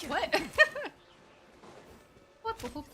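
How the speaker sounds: ordinary talk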